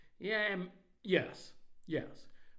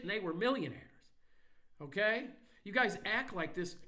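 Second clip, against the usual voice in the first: ordinary delivery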